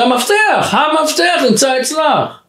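high-pitched, emphatic call; insistent declaration. Retold or imitated. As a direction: call it back to her, pitched right up. He calls back to her insistently. (הכרזה נחרצת בטון גבוה)

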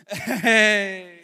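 loud laughing yell of delight; delighted shout. Retold or imitated. Dad cheering daughter's comeback, loud laughing yell. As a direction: burst out cheering. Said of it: "I was like".

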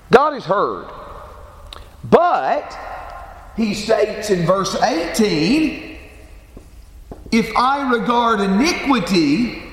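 rising, slow, emphatic pulpit voice; preaching. Acted. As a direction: build the sermon, leaning on each phrase. Preacher builds to full sermon voice.

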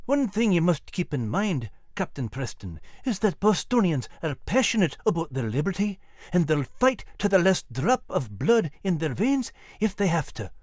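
warning. Acted. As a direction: caution an officer in a Scottish accent. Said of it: "Sergeant Frost warned".

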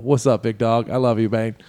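normal talking voice

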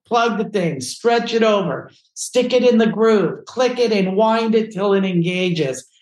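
high, insistent mock-impatient orders; acted exasperated instructions. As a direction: drill each step in, imitating a fed-up friend. Acted exasperated friend giving orders.

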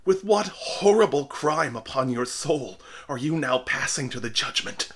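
frightened minister accusing the dying man; affrighted. A narrator's reading. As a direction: keep the horror from the outburst. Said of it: "exclaimed the affrighted minister".

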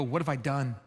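soft, sinking self-question; a quiet groan of regret in words; regretful murmur. Retold or imitated. Imagined hungover morning regret.

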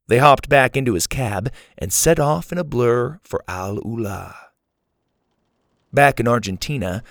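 ordinary delivery

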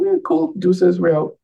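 breezy high exit. Retold or imitated. Retelling own breezy exit.

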